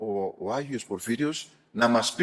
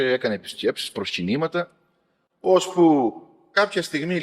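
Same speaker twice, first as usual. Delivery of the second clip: ordinary delivery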